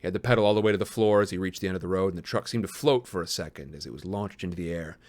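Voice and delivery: low, hushed storytelling voice; story narration. A narrator's reading. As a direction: read it like a dark tale, drawing it out. Reads a dark story aloud.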